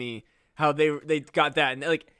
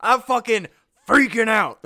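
louder, higher panicky rant; frantic old man; 4 semitones higher, 7 dB louder than usual. Pretend. Old man reveals his secret, panicky and loud. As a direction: lose it like a maniac.

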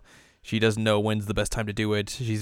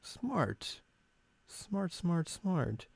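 quiet admiration; 9 semitones higher, 9 dB quieter, much slower than usual. Real, not acted. Admiring Ui's trick.

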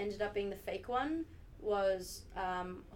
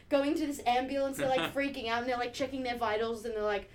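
usual speaking voice

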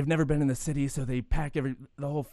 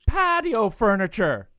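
higher, louder and slow punchline; punchline reveal; 9 semitones higher, 8 dB louder, slower than usual. Acted. Comic telling his Irish joke to host, punched out. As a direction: land the pun big and slow.

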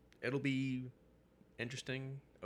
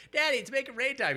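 put-on much higher, loud kid voice; child voice; 10 dB louder than usual. Jim Lee's kid calling daddy for money time, high and excited.

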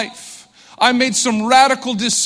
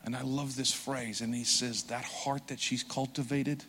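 hushed, tender sermon voice, softer; soft intimate preaching; 9 semitones lower, 13 dB quieter than usual. Acted. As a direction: draw them in quietly, like sharing a secret.

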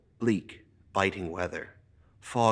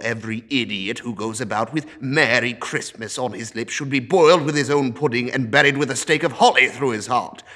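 loud, building furious tirade; angry rant; 3 semitones higher, 9 dB louder than usual. Acted. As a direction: rage at the fools of Christmas. Scrooge rants at nephew.

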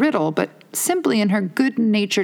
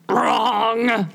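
higher, gleeful mock cry of victory; triumphant exclamation; 6 semitones higher than usual. A narrator's reading. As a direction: pounce on it like you've won. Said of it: "Humpty Dumpty exclaimed triumphantly".

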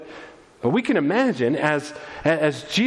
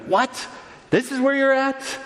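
mild mock indignation, pitched up; irritated, provoked; 9 semitones higher than usual. Parents finding their missing son at temple, irritated. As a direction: act out an affronted, disbelieving question. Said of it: "is kind of irritated, provoked, angered".

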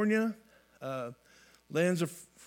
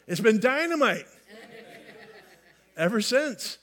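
higher, louder burst on dynamite; emphatic retry; 3 semitones higher, 3 dB louder than usual. Shown as a demonstration. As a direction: punch it up with big enthusiasm. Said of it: "I should try that again".

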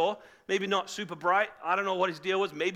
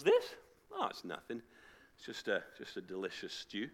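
feigned nonchalance; 8 semitones lower, 6 dB quieter than usual. Acted. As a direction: play it coy, as if it were nothing. Jacob coyly showing off his stew.

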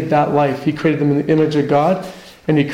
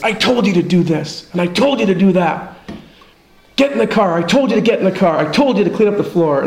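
imitating a harsh dad, higher and pushed; aggressive; 4 semitones higher than usual. A dad ordering his kids around, aggressive mock scolding. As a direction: bark the orders like an impatient father. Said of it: "but in an aggressive way".

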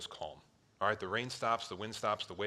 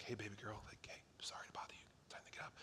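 put-on soft whisper, like waking a sleeping child; gentle whisper; 16 dB quieter, much faster than usual. Father whispering to wake his daughter, soft and gentle. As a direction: whisper gently so you don't startle her. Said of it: "when I wake up my daughter in the morning".

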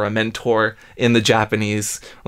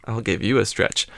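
ordinary delivery

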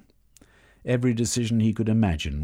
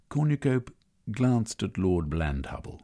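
plain reading voice

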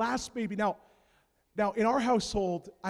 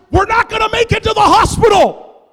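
much higher, much louder shout; panicked yell; 13 semitones higher, 16 dB louder than usual. Retold or imitated. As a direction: yell from labor. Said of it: "She's like".

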